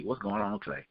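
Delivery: ordinary delivery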